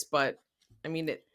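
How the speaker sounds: normal talking voice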